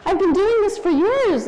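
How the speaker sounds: very high, comic protest; hammed-up commiseration way up in pitch; mock-wailing empathy. Mother answering daughter who called her a loser, mock-wailing empathy.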